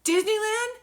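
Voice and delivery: high, loud squeal of disbelief; mock overjoyed shriek; imitating excited sister. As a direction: act out a childlike, thrilled question. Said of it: "she gets all excited".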